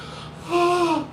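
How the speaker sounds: high, startled gasp; sharp gasp. Real, not acted. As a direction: catch your breath in shock. Reliving daughter's crying.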